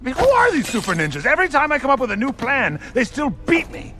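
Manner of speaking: high-pitched, worked-up tirade; exasperated rant. Acted. Warlord berates generals over losing to ninjas, exasperated rant. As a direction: rant at them, voice pitched right up.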